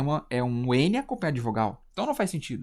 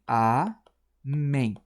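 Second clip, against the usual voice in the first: "amém" split in two, drawn out slowly; syllable by syllable; 2 semitones lower than usual. Shown as a demonstration. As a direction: pause between the syllables of "amém". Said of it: "esqueci de separar". ("amém" devagar, sílaba por sílaba)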